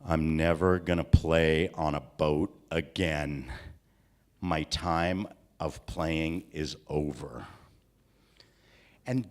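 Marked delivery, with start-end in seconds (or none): 0.0-7.5 much lower, slow dejected voice; hammed-up sad declaration; mock sad vow. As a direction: announce it like a heartbroken boy. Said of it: "and I said".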